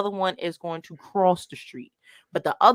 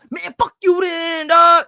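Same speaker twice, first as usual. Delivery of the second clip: higher, louder hammed-up rejected snap; mock angry shout; 9 semitones higher, 9 dB louder, slower than usual. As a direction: yell it back like a spurned guy. Rejected guy snapping back.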